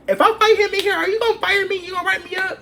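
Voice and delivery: high, loud excited asking; eager question. Retold or imitated. Asking her young manager about fighting, high excited voice. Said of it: "I was like".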